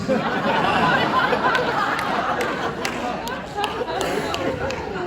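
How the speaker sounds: high, long laugh at himself. Real, not acted. Preacher laughing after mocking the lost weights. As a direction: laugh at your own punchline. Laughing at his own joke.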